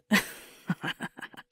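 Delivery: breathy run of laughter; helpless giggle. Acted. Still laughing at a partner's stomach, helpless giggle. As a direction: keep giggling, then wind down.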